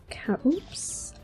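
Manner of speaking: ordinary talk, nothing marked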